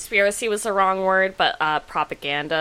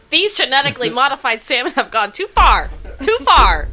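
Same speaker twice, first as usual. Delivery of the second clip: mock outrage; 7 semitones higher, 7 dB louder than usual. Mock outrage about salmon.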